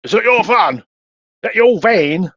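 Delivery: high, loud mock Londoner; London accent. Bloke on the bonnet to the driver, London accent. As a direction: challenge him in a put-on London accent. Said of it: "Proper Londoner".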